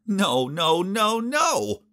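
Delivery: escalating panicked no's, rising to a cry; horrified denial. Acted. Narrator realizing his girlfriend is his enemy's sister, panicked rising cry.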